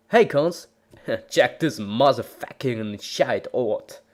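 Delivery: ordinary delivery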